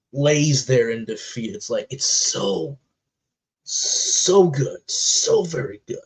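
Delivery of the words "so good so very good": breathless praise; overcome with awe. Real, not acted. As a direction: pitch soaring on good, slowed. Overwhelmed praising the scene.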